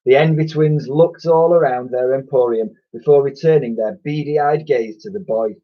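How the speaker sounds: plain reading voice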